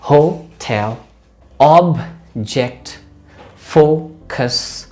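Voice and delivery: Hotel, object, focus each in two separated beats; syllable by syllable. Shown as a demonstration. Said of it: "दो syllable वाल के".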